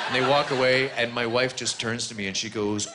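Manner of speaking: normal talking voice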